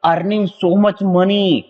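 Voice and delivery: teacher reading out an example sentence slowly. Shown as a demonstration. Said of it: "तो कह सकते हैं".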